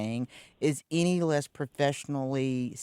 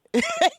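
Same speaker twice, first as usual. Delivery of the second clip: high burst of laughter; 5 dB louder than usual. Real, not acted. Laughing at the homegirl line.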